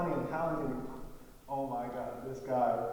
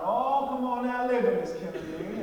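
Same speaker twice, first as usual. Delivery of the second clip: louder, higher southern-man imitation; mock southern man shrugging off health; 8 semitones higher, 8 dB louder than usual. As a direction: play him begging loudly. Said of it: "real deep, real southern, real loud".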